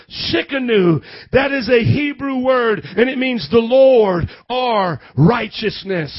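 loud, emphatic pulpit voice; forceful preaching. Real, not acted. Preacher announcing a scripture and Hebrew name, loud preaching.

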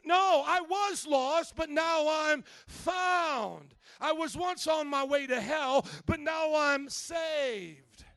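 high, very loud preaching with long-held final words; full-force victorious declaration; triumphant shouting. Real, not acted. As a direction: roar the correction, stretching the last word. Preacher shouts down the whispered lament.

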